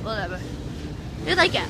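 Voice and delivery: normal talking voice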